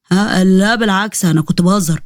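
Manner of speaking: slow, drawn-out nervous denial; hesitant slow denial; awkward backpedal. Acted. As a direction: stall while you take it back. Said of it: "Rakan backpedalling hastily".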